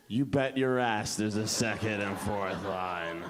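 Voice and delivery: low, slow, swaggering reply; mock-confident low voice; cocky assurance. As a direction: say it deep and drawn out. [name]'s cocky answer in the pitch.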